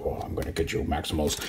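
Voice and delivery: low-volume, husky villain threat; menacing villain. Pretend. Reviewer voices the toy threatening the Maximals, quietly menacing. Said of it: "look all menacing".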